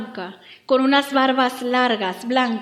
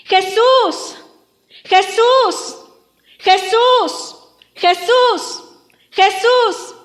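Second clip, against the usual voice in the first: high, loud cry for help; insistent, pleading yell; shouting; 5 semitones higher, 6 dB louder, slower than usual. Acted. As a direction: call out desperately as the blind beggar. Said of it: "Y entonces comenzó a gritar".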